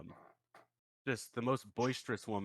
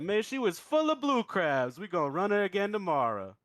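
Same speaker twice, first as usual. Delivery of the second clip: punchline sheriff voice; 10 semitones higher, 7 dB louder than usual. Acted.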